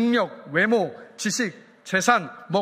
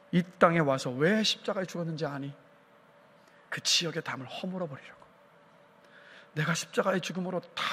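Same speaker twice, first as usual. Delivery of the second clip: hushed, low reproach with long pauses; the Lord; 4 semitones lower, 5 dB quieter than usual. Acted. Jesus reproaching a divided church, quiet and grave. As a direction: speak softly and low, letting each phrase sink in. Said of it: "주님은 이렇게 말씀하실 겁니다".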